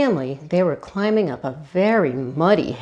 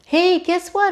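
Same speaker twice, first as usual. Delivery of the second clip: higher excited call; 11 semitones higher than usual. A narrator's reading. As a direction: call out proud news. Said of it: "Arlo called out".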